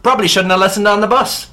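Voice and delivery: high, loud mock Ulster accent. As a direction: belt out a hammed-up Northern Irish accent. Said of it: "I'll try just Ulster".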